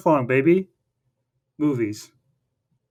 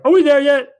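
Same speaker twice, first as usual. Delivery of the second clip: much higher, louder nagging Donkey; whiny Donkey; 11 semitones higher, 9 dB louder than usual. Retold or imitated. Donkey nags on the carriage ride, high and loud. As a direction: pester like an impatient kid. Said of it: "Donkey's like".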